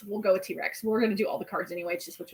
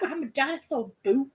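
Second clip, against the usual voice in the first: put-on sad kid version; 3 semitones higher than usual. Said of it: "this kid was just so sad".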